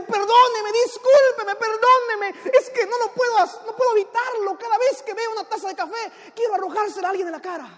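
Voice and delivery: high-pitched, rushed begging for pardon; overwrought, pleading excuse; frantic apology. Acted. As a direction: plead desperately, words tumbling out high. Man begs forgiveness after outburst.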